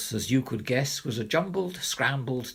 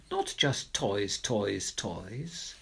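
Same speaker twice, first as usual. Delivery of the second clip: sad fade; 6 semitones lower, 5 dB quieter, much slower than usual. Acted. Lonely child fading sadly.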